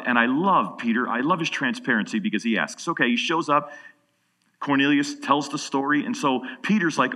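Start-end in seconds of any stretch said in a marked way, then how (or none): none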